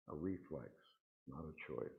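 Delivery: a quiet repetition for emphasis; soft echo. Shown as a demonstration. Speaker to congregation, echoing the point softly. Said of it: "generosity is a reflex, not a choice".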